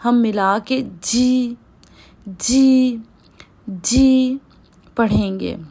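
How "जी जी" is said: जी through joined teeth; pinyin j sound. Shown as a demonstration. Teacher showing pinyin j with teeth joined. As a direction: clamp teeth and say जी. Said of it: "ऊपर वाले नीचे वाले दांतों को".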